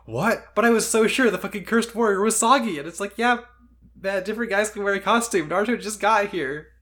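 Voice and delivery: exasperated fan mocking a confused ninja; mock exasperated character voice. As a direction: act out the confused ninja and snap back. Voicing Kobe then retort.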